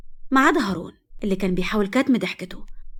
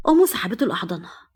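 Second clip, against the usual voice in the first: usual speaking voice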